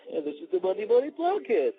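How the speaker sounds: goofy, slurred echo of an excited announcement; mock excited replay. Shown as a demonstration. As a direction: parody your own excited intro. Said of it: "my voice went up as if to say".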